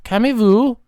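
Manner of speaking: calm him down, drawing out the name; lightly voiced placating friend; placating person. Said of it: "the person is like".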